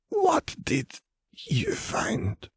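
whispering. A narrator's reading. Vera asks eagerly.

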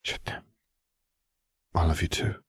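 soft whisper. Acted. Harry replies softly.

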